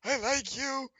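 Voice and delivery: high, loud, gasping barkeep; raspy, gaspy voice. Acted. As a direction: squeeze it out high, short of air. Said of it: "with a raspy, gaspy voice goes".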